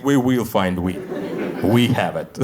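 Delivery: low threat, planting the evidence; Russian cops. Retold or imitated. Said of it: "exactly like Russian cops".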